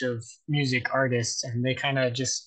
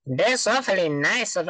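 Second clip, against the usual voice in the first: high, swooping cartoon character voice; higher, lilting put-on character voice; Mister Lunt; 4 semitones higher than usual. Shown as a demonstration. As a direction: attempt a cartoon impression, pitched up. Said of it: "go for Mister Lunt".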